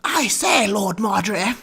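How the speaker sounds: high, loud, hammed-up posh English cry; mega posh accent. Streamer mocking Downton-style voice actors, shouted posh accent. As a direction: call out like a posh English aristocrat. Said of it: "sound like mega posh".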